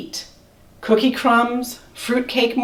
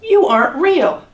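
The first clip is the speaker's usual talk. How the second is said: squeaky mouse; 7 semitones higher than usual. Acted. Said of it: "Mortimer squeaked".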